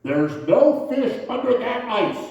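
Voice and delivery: loud, slow booming voice. Retold or imitated. Mystery voice warning the fisherman again, loud and slow. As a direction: boom each word slowly from above. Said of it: "Again, the voice".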